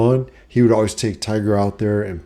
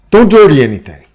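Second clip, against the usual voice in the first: loud, higher, bossy warning; nagging mom; 6 semitones higher, 10 dB louder, faster than usual. Retold or imitated. Mom warning son after cleaning house, loud. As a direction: bark the order at the kid walking in. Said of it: "And she's like".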